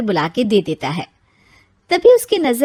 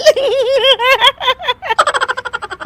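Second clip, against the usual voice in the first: shrill stifled giggles; a fit of giggles she can't stop; high-pitched helpless giggling. Acted. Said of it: "हंस मत".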